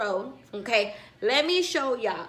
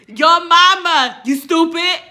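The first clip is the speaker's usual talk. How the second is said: high, loud, slow comeback; yelled translation; 5 semitones higher, 11 dB louder, slower than usual. Retold or imitated. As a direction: shout the insult back. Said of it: "Which is".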